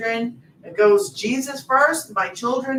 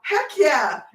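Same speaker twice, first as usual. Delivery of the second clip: high, loud cheerful exclamation; quoting a friend's excited catchphrase; imitating an enthusiastic viewer; 6 semitones higher, 6 dB louder, slower than usual. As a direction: mimic her trademark catchphrase with gusto. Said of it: "And then she goes".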